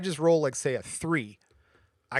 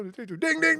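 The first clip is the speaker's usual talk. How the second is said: slot machine dings. Retold or imitated. Husband winning at slots, high chiming dings. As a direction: chime like a paying slot.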